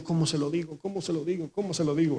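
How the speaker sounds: hushed nervous self-talk; anxious muttering. Retold or imitated. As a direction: fret quietly over how to say it. Peter fretting how to tell Jesus.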